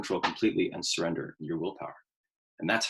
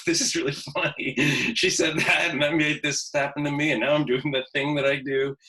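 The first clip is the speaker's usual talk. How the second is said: amused laughing; 5 semitones higher, 5 dB louder than usual. Retold or imitated. Laughing at his own reaction.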